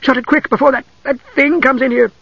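high-pitched alarm; urgent cry. A narrator's reading. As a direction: urge them in a frightened, high voice. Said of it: "Usher cried urgently".

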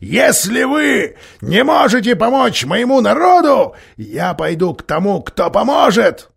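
loud, high shrill shriek; shrill scream. A narrator's reading. Dwarf threatens to go to another helper, shrill loud shriek. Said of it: "срываясь на пронзительный крик".

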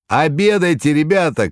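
loud, high call to the crowd; shouting. A narrator's reading. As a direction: call out over the hall. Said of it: "кричали повара".

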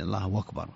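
"الله أكبر" as "Allahwakbar", said in one glide; hamza turned to waw. Shown as a demonstration. Sheikh showing the dialect substitution, "أكبر" said as "wakbar". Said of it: "إبدال الهمزة،".

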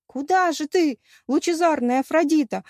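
high, loud call after her; pleading outcry. A narrator's reading. Rejected admirer calling after the departing goddess, loud outcry. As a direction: cry out like a spurned suitor. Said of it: "вскричал отверженный поклонник".

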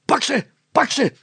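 high, loud roar at the dog. A narrator's reading. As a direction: yell to set the dog loose. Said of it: "loeide Meiling weer".

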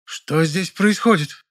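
high, slow demand; a woken neighbour's high, slow demand; sleepy indignant woman. A narrator's reading. (сонная возмущённая соседка)

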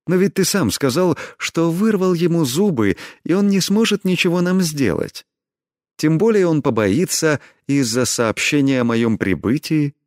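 high, sweetly pleading voice; sweet as nectar. A narrator's reading. Heroine begs her lover to take her along, honey-sweet. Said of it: "голос стал просто сладким нектаром".

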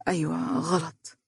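barely audible, head bowed in shame; faint murmur. A narrator's reading. Faris quietly admitting to Bilal he is wrong, very soft. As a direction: let the admission fall almost silent. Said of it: "وقال بخفوت".

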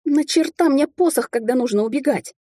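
high, irritated retort; snapping back. A narrator's reading. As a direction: snap it back, exasperated. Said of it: "огрызнулась я".

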